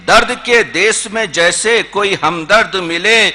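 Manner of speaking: lilting refrain in a steady metre; Urdu poetry recitation. Acted. Poet presents a poem on children's laughter, recited with lilt. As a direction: recite rhythmically, lifting and settling line by line.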